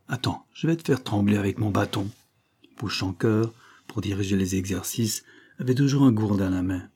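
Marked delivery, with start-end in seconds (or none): none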